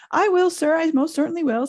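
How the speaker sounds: high, loud, over-eager agreement; fawning. A narrator's reading. Peeves obeys the supposed Baron, eagerly fawning. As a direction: agree servilely, pitch leaping up.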